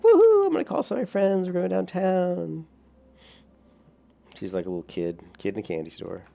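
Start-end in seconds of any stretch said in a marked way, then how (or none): 0.0-2.7 put-on excited girlfriend. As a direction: imitate her squealing with party excitement. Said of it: "she gets excited. She's like".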